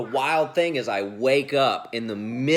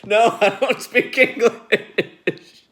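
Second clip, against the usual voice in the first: mock wife reply; 11 semitones higher, 9 dB louder, slower than usual. Imitating wife's sleepy reply.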